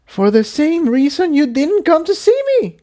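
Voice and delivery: very high, sharp retort; mare snapping back. A narrator's reading. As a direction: fire the words straight back at him. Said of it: "Tiptoe shot back".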